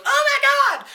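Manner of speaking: high, loud mock scream; hammed-up shriek of horror; horrified cry. Mom's horror at the killed cat.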